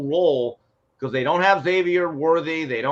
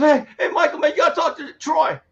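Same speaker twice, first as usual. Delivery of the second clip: a hint of Dion's voice, pitched much higher; imitating Dion; 10 semitones higher, 3 dB louder than usual. Retelling Dion rushing up to Michael in the locker room, mildly voiced high. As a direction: lightly mimic an agitated teammate. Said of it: "Dion comes in and goes".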